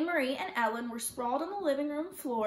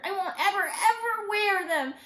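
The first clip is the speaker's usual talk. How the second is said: put-on high, loud, defiant child; sputtering protest; 7 semitones higher, 4 dB louder than usual. A narrator's reading. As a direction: refuse stubbornly, stretching the words. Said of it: "Kirsty sputtered".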